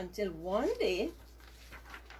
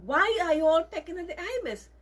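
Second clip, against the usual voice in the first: upset mother bird; 8 semitones higher, 5 dB louder than usual. Acted. Mother scolds her chicks.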